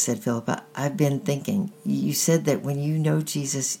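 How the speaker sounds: plain reading voice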